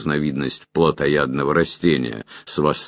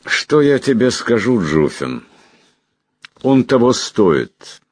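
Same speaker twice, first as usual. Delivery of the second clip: put-on old man voice; 8 semitones higher, 4 dB louder than usual. Old magister voiced in story.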